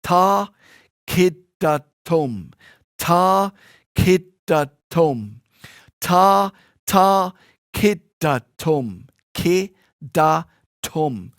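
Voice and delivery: very slow, separated syllables; deliberate, spaced-out rhythm syllables; pronouncing every syllable. Shown as a demonstration. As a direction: draw out each syllable and articulate it fully. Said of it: "pronounce all of the syllables".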